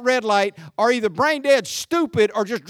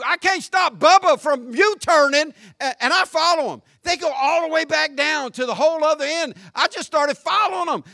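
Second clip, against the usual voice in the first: ordinary talk, nothing marked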